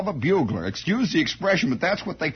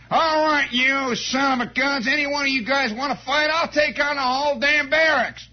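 aggressive Southern tough; 8 semitones higher than usual. Retold or imitated. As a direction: bellow the dare like a drunk Southern soldier. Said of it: "He's always saying".

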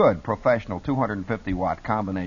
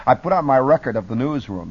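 ordinary talk

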